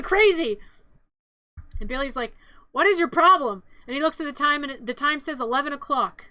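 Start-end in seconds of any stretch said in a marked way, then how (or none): 2.7-3.6 loud, higher-pitched mock snap; exasperated. Recapper voices Billy scolding the Mogwais, exasperated. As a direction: act fed up with noisy little creatures. Acts Billy snapping at noisy creatures.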